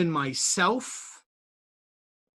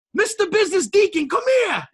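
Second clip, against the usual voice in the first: high, louder call across the room; 6 dB louder than usual. Retold or imitated. Owner walks in and calls him over, high and loud. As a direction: summon him by his nickname. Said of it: "he goes".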